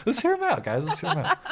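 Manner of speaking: squeaky high mock-reasonable voice; hammed-up high let's hear him out; mock plea. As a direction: play it as a high silly plea.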